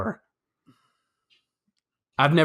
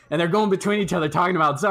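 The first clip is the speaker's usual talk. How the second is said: ordinary delivery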